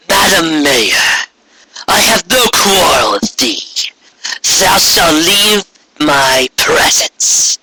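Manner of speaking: high, slow, imperious royal decree; regal demon queen. Acted. Returned queen demanding the village present itself, imperious. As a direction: command the cowering ponies like a returned tyrant. Corona dismissing the mayor.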